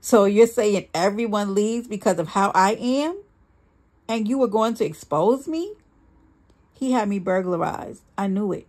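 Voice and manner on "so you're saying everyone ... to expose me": indignant questions, climbing at the ends; anger. A narrator's reading. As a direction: challenge them in rising indignation. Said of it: "There was only anger in his response".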